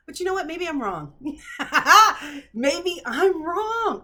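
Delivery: high, loud laughing concession. Real, not acted. Vlogger laughing as she admits she may be wrong. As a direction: laugh and shrug it off, slowly.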